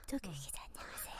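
hushed greeting; playful whisper. Pretend. Host greeting her co-host, whispering. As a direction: drop into a whisper. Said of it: "제가 속삭였어요".

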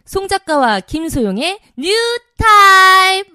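slow, loud show title call; announcer call. Acted. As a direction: belt out the show name.

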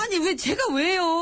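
high, drawn-out wail of grievance; whining protest. Acted. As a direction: protest the accusation as if near tears. Accused aide protests.

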